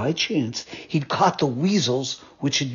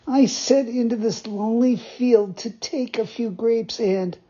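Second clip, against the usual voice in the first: pleading puppet; 9 semitones higher than usual. Acted. Puppet explains himself.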